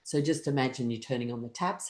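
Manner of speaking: ordinary delivery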